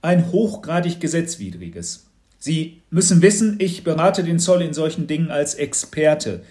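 lecturing. A narrator's reading. Expert to father about the animal, lecturing. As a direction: instruct him as the authority. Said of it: "belehrte ihn Herr Schrepp".

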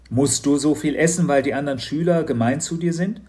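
a hint of a concerned dad; lightly voiced worried father. Papa worries. (besorgter Vater, leicht gesprochen)

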